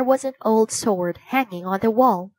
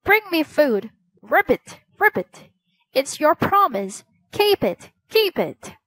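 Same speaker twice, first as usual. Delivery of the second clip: croaking frog voice; 6 semitones higher than usual. A narrator's reading. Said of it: "the frog croaked again".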